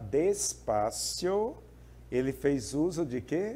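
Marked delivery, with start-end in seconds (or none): 0.0-1.6 drawn-out, unhurried "despacio"; slowly. Shown as a demonstration. Teacher showing students how slowly the speaker talked, slowly. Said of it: "Foi devagar".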